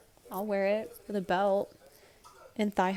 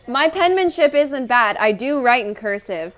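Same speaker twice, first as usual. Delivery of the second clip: mock indignant; 4 semitones higher, 13 dB louder than usual. Real, not acted. Defending her handwriting loudly.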